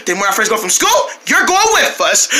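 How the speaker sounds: ordinary talk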